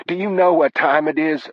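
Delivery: high-pitched scolding mother; high, indignant mother on the phone. Acted. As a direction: scold a late caller, voice pitched right up. Mother scolds late-night caller.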